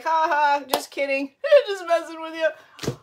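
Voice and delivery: laughing it off, slowed and drawn out; laughing cover-up. Retold or imitated. Mom to daughter, laughing her mistake off as a joke. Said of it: "And I was like".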